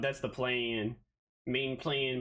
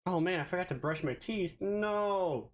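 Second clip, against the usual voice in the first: normal talking voice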